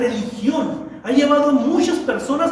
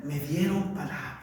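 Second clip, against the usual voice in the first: mock hushed boast; 8 semitones lower, 11 dB quieter than usual. Mocking what believers always say.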